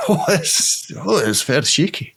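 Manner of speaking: comic put-on Scottish accent; Scottish Italian accent. Co-host feels the ground shake, in a comic Scottish accent. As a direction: answer as a hammed-up Scot. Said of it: "Scottish Italian".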